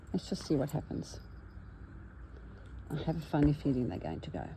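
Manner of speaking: ordinary delivery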